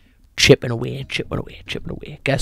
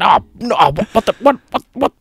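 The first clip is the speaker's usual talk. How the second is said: mock panic; 11 semitones higher, 4 dB louder than usual. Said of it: "I'm panicking".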